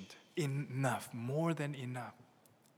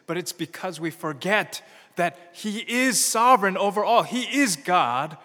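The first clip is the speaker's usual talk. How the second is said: preaching at full force; 4 semitones higher, 9 dB louder than usual. Acted. Pastor rousing the congregation on Jesus' kingship, full-force preaching. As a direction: thunder that he reigns today.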